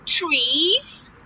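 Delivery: ordinary talk, nothing marked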